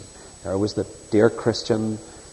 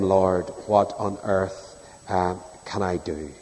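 slow pleading prayer; 5 semitones lower, 6 dB quieter, slower than usual. Retold or imitated. Retold desperate prayer to God.

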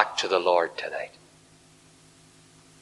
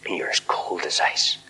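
softer, whispered warning; 3 semitones lower, 6 dB quieter than usual. Acted. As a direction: lean in and whisper it. Preacher confides to backslider.

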